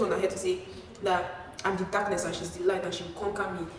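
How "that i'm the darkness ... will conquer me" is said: usual speaking voice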